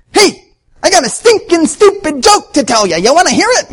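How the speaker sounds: high, loud mocking sing-song, ribbing the coworker; mocking taunt. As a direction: taunt him in a shrill-pitched mock voice. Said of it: "Todd would go up to him and say".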